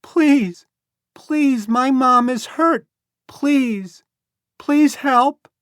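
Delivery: put-on high, loud, desperate child's pleas; boy begging. A narrator's reading. Lost boy begs Becky to help his mom. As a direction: beg for help, frantic. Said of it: "the boy shouted".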